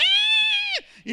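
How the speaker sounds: piercing high mock cry; loud, squealed tantrum wail; imitated child's bawling. Children crying for a toy, imitated shrieking bawl. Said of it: "Están llorando".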